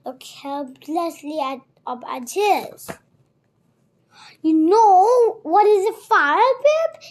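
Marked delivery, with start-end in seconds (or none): 4.4-7.0 loud, high demanding question; forceful questioning. A narrator's reading. Captor quizzing the boy, forceful loud questioning. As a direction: press the boy hard, voice raised.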